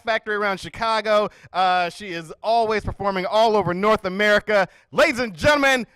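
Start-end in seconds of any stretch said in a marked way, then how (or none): 4.9-5.9 loud, slow, higher emcee shout; announcer hype. Acted. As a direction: bring the crowd to their feet. Host introduces headliner.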